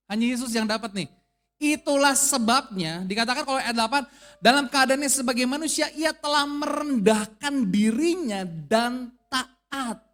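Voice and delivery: loud, emphatic sermon climax; fervent preaching; preaching at full force. Acted. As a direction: drive the point home to the congregation.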